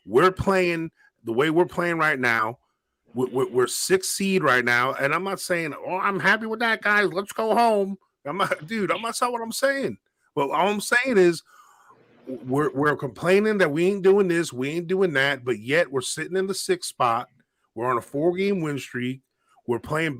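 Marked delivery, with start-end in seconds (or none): none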